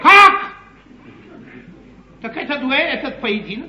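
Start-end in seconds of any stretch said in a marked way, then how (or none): 0.0-0.5 sharp, high, loud cry of shock. Acted. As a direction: jolt out the word in alarm. Shocked by news of the duel. (потрясённый возглас)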